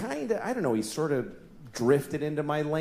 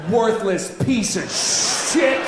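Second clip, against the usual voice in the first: louder, slow and drawn out; slow loud insult; 2 semitones higher, 4 dB louder, much slower than usual. Retold or imitated. Comic replaying his road rage insult, slow and loud. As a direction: spit it out slowly with contempt. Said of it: "road rage insult".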